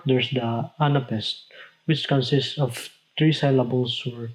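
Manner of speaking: ordinary delivery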